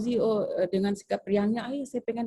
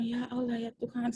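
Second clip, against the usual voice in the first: softer, despairing sigh to a friend; quiet lament; 4 semitones higher, 6 dB quieter than usual. Retold or imitated. New teacher laments quietly to Bu Ratna. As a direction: moan under her breath. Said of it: "saya kasih tahu Bu Ratna".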